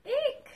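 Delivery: high startled eek; scared squeak. Acted. Reader squeaking at the shark, startled and high. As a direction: squeak in mock fright.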